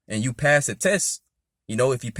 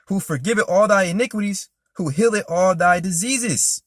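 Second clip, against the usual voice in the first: louder, higher preaching read of the verse; fervent psalm reading; 6 semitones higher, 5 dB louder than usual. Acted. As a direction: proclaim it to the congregation.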